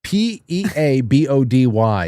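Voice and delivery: Peabody spelled P E A B O D Y, letter by letter, slowly; spelling it out. Shown as a demonstration. Host spelling the name to prove his point. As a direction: spell each letter out with slow emphasis. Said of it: "for any normal person".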